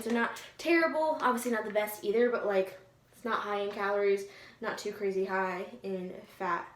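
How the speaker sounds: normal talking voice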